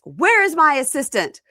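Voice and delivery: high, loud summons; hammed-up priestly holler; imitated priest calling. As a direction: call out imperiously for help.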